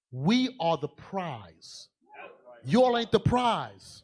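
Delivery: drawn out, each word weighted; slow preaching emphasis; slow emphatic declaration. Real, not acted. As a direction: spell it out slowly for the women. Preacher drives home point.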